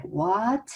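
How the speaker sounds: WHAT sliding up like a question; questioning rise. Shown as a demonstration. Teacher likening second tone to a question, rising WHAT. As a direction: lift WHAT at the end. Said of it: "like you're asking question".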